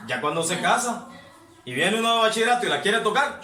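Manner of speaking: ordinary talk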